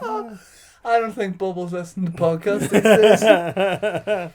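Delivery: high, slow, talking through laughter; laughing. Real, not acted. Joking about Bubble hearing the podcast, laughing hard. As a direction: say it while cracking up.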